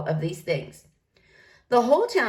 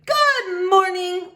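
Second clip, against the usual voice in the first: drawn-out, high, loud hello; grand entrance greeting; booming cheery greeting; 10 semitones higher, 5 dB louder, much slower than usual. Acted. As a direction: sing out a big slow good morning. New librarian bursts in cheerfully.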